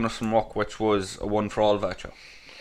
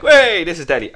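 excited; 7 semitones higher, 11 dB louder than usual. Retold or imitated. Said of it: "I was like".

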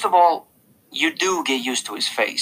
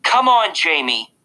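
exasperated mother, high burst then dropping; cross mom's telling-off; angry; 5 semitones higher, 5 dB louder than usual. A narrator's reading. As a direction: snap at your son, loud first words. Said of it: "said Mom angrily".

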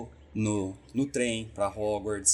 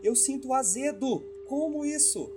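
much higher, amazed disbelief; baffled cousin; 14 semitones higher than usual. Retold or imitated. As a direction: cousin tasting orange in plain water. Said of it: "ela tomava assim".

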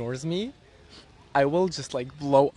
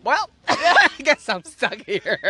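mock stranded wife; 5 semitones higher, 3 dB louder, slower than usual. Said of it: "She's like".

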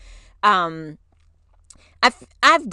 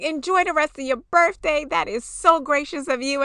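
much higher, grateful; gracious thanks; 7 semitones higher than usual. Retold or imitated. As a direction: thank her warmly. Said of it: "they're like".

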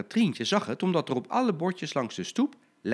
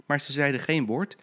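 ordinary delivery

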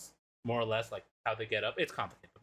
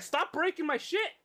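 mock angry yell. Robot yelling at intruder.

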